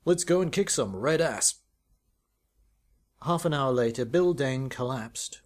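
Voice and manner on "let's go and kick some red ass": louder, clipped gung-ho officer rallying his team; a keen leader sending the troops off; clipped commander. A narrator's reading. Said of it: "clipped syllables".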